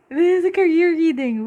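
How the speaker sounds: high giddy squeal of excitement. Real, not acted. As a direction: burst out, giddy with delight. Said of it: "I'm feeling so clear".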